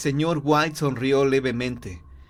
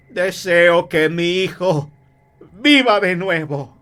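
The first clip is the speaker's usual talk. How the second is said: loud, slow, raised invocation; solemn wish; 5 semitones higher, 7 dB louder, slower than usual. Acted. Husband wishes on the talisman, loud incantation. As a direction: proclaim it word by word.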